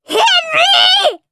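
piercing, very high, loud screech. A narrator's reading. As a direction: screech the name like an outraged dance teacher. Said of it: "screeched Miss Tutu".

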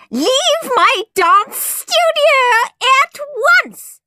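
very high, slow, drawn-out screeched order. A narrator's reading. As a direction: screech a furious dismissal, stretching the words. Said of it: "screeched Miss Tutu".

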